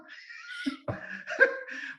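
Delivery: shrieking burst of laughter. Real, not acted. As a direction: laugh out the absurdity. Laughs at the irony.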